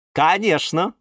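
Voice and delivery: high, flirty self-satisfaction; coquettish. A narrator's reading. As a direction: preen coyly at the praise. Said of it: "кокетливо хихикнула орхидея". (кокетливо, высоким голосом)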